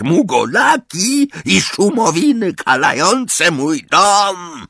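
put-on a furious old portrait screaming; shrieking portrait. A narrator's reading. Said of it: "rozległ się wrzask".

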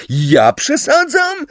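very high, drawn-out incredulous cry; shouted indignant question. A narrator's reading. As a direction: shriek it back in outrage. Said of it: "krzyknął Simius".

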